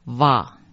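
"哇" with an English V sound, va; pronounced va. Shown as a demonstration. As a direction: start "哇" on a V, as in V-A. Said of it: "V A这样的发音".

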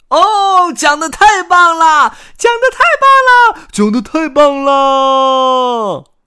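very high, loud cheers; ecstatic cheering. Acted. Audience praises the new storyteller, ecstatic high shouting. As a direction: cheer wildly, stretching the last one out.

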